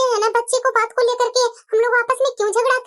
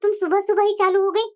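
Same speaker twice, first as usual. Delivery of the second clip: lower, tired mother-in-law; a weary old lady sighing at a quarrel; old woman, lower voice; 7 semitones lower than usual. Acted. Mother-in-law wearily answering angry daughter-in-law, lower voice.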